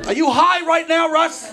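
shouted, high and loud mom; mock yell. Mom yelling at her son on the phone, loud mock shout.

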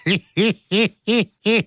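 light high chuckle; high laugh. Real, not acted. Host laughing at his own flub.

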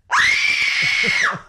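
put-on high, piercing girlish scream. Shown as a demonstration. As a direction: let out a full-force girl scream. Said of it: "like, did a girl scream".

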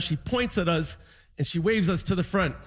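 ordinary delivery

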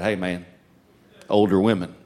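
very low, softened confiding voice; low, cool boyish aside. Retold or imitated. Boy to his buddy about the girls, low cool aside. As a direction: drop it way down, playing it cool.

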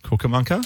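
Cucamonca, 'cook-a-munk-a', for cucumber; children's mispronunciation. Retold or imitated. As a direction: imitate kids garbling cucumber into Cucamonca. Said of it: "my kids always said".

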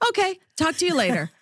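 high, fast mock of mom hanging up; brisk mom. Daughter mimics her mom ending the call, high and fast. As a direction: rattle it off to end the call. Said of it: "And I'll say".

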